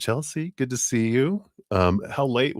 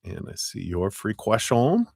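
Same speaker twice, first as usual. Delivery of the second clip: put-on French accent; 2 semitones higher than usual. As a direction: put on a playful, not very good French accent. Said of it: "like, my French accent".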